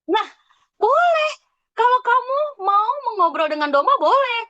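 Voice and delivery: bright playful teacher tone; playful high voice. Real, not acted. Teacher playfully humoring a pupil.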